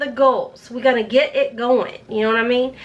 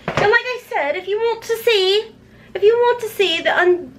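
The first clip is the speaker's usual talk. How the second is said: lightly voiced playful other self; a hint of a goofy second personality; silly alter ego voice; 7 semitones higher than usual. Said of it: "my multiple personalities are coming out".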